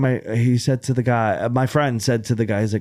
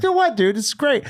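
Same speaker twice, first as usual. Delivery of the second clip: much higher, eager and cheery; upbeat and positive; 12 semitones higher than usual. Retold or imitated. Comic brushing off the booker's panic, high and eager. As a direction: chirp it out full of optimism. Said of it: "very positive about it".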